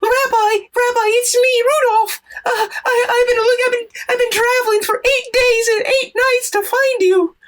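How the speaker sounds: very high squeaky voice, on the edge of tears; a tiny reindeer sobbing out his journey; tearful reindeer. Acted. Rudolph telling the rabbi of his journey, tearful and squeaky.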